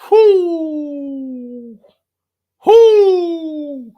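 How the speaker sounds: long loud high whoo. Real, not acted. Fan mocks his own team with a loud whoo. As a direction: let out a sarcastic whooping cry. Mocking cheer about Cowboys future.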